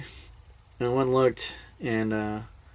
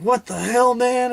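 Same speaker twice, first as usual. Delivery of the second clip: high, louder incredulous outburst; acted disbelief; 13 semitones higher, 4 dB louder, slower than usual. Buddy realising there is another deer, acted disbelief. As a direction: imitate a buddy who can't believe it.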